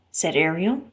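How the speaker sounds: usual speaking voice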